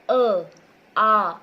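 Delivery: loud model vowels; clear vowel demo. Shown as a demonstration. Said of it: "बिना रुके मेरी आवाज बाहर आ रही है".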